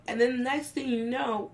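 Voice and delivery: normal talking voice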